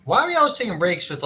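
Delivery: ordinary talk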